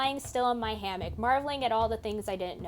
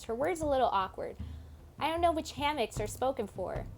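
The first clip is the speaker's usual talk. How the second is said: ordinary talk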